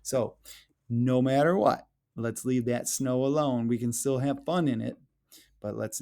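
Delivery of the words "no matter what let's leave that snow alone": ordinary talk, nothing marked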